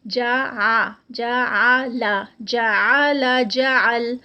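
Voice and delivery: جا آ لا drawn slowly, then جا ال with a prominent ع; recitation contrast. Shown as a demonstration. Teacher contrasting a hamza reading with a proper ain. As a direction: chant the syllables, marking the ع. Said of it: "جا آ لا میں ہم نے اس کو ہمزہ پڑھا ہے".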